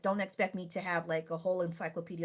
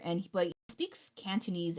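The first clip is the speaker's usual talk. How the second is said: ordinary delivery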